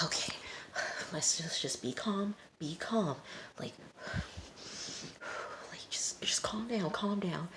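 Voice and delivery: hushed, half-whispered mock nerves; scared self-calming. Friends nearing the border, scared whispering. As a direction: whisper as if steadying panicked nerves. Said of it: "They're scared. They're like".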